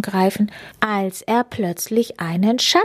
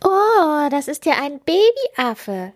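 giggle; 8 semitones higher, slower than usual. A narrator's reading. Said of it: "kichert Linus".

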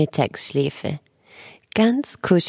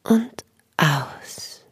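whispered. Shown as a demonstration. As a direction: whisper it slowly. Breathing guidance, second out-breath whispered.

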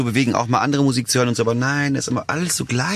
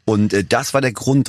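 ordinary talk, nothing marked

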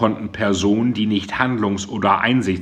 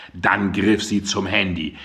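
usual speaking voice

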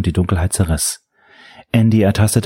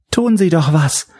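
high, desperate cry for help; desperate scream; 9 semitones higher than usual. A narrator's reading. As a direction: scream it in despair. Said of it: "verzweifelt schreien".

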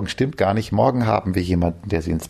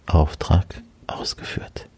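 whisper; 2 semitones lower, 3 dB quieter, slower than usual. Real, not acted. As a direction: whisper so nobody wakes. Child asleep, whispering.

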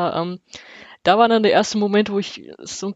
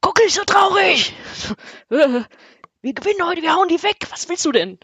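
wrestling promo; 10 semitones higher than usual. Retold or imitated. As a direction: bellow it like a pumped-up fan before kickoff. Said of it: "mit dieser Stimme brüllte er mich zusammen".

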